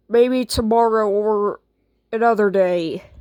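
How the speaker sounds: ordinary talk, nothing marked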